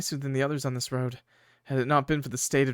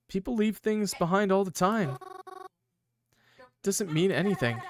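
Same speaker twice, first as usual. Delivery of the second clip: put-on high-pitched girly character voice; Mary; 7 semitones higher than usual. Skeptic arguing about abandoned things, high girly voice. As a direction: pitch it up for a makeshift girl voice. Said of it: "so I just have to do high-pitched".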